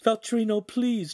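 high-pitched desperate plea; captive's anxious, high entreaty; pleading. A narrator's reading. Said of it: "Giulietta pleaded".

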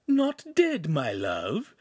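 scornful, swooping imp; mocking echo. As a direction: echo it with theatrical disbelief. Imp echoes him mockingly.

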